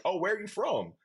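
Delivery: high-pitched retold question; imitating Dabble. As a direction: lift it high, imitating him asking the newcomer. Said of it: "and he's like".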